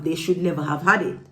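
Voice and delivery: ordinary delivery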